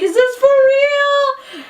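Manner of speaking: high, loud, drawn-out question; mock teary wail; imitated tearful disbelief. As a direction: stretch it out, slow and overcome. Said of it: "you know, tearing".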